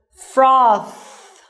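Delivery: "Froth" with a long voiceless th; unvoiced ending. Shown as a demonstration. Teacher showing no throat vibration, th voiceless and held. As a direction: let only air out on the th of "Froth". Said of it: "this is unvoiced sound".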